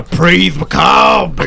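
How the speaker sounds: slow, emphatic mock preacher shout; drawn-out preacher call; Leon Phelps as reverend. Shown as a demonstration. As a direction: belt out praise like a comic reverend. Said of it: "but, like, a reverend".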